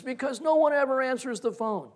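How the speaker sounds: mildly angry, high-pitched mock retort; retold angry neighbor. Angry gentleman complaining about unanswered phone, imitated high retort. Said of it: "mad enough to spit nails".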